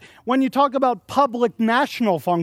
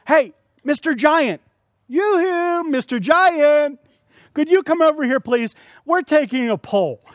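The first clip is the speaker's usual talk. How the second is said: calling out; 5 semitones higher than usual. Pretend. Spy calling to the giant.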